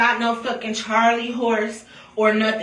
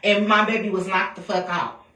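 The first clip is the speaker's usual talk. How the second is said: ordinary talk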